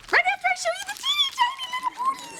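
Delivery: fast, high, teasing squeal. Real, not acted. Gushing again over tiny ponies.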